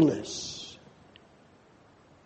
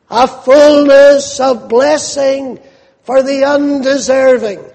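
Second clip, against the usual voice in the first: louder, building sermon delivery with pounded words; fervent preaching; 4 semitones higher, 5 dB louder than usual. Acted. Preacher to congregation on the fullness of grace, rising fervent preaching. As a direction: preach it out, driving each "full of grace" home.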